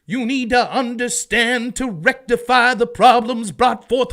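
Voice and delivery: loud, slow, high Southern preacher sermon; fiery Southern preacher. Retold or imitated. Mocking a politician as a fiery Southern preacher. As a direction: thunder it from the pulpit like a Baptist preacher. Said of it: "She started talking like she was a Southern preacher".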